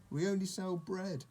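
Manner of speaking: high-pitched, mildly baffled correction; protesting shopkeeper. A narrator's reading. Shopkeeper to confused old sheep, baffled high protest.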